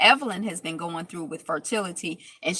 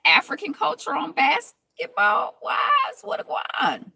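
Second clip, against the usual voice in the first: mock amazement; 7 semitones higher, 4 dB louder than usual. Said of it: "I'm just like".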